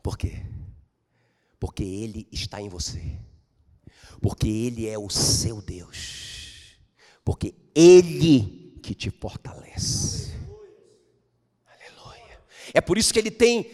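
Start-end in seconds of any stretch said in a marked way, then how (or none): 1.6-10.3 slow each phrase down, let it land; slow intimate preaching. Acted. 11.7-12.4 breathy whisper of praise; whispered Aleluia; whispered hallelujah. Real, not acted. Whispered aside.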